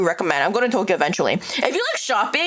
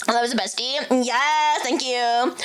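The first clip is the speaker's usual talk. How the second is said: ordinary delivery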